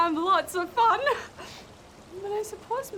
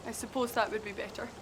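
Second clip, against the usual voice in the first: mock serious; 8 semitones lower, 8 dB quieter, faster than usual. [name] tries being serious.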